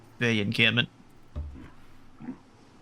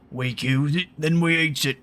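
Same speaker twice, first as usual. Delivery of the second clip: grumbling giant; 4 semitones higher than usual. A narrator's reading. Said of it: "grumbles Grump".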